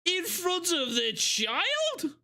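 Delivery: high, very slow mock outrage; incredulous. Real, not acted. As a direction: stretch it out, scandalised. Shocked reaction.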